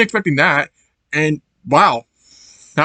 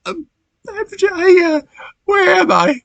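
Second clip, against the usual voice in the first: much higher, lost puppy voice; confused Chase. Retold or imitated. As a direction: squeak it out like a bewildered pup.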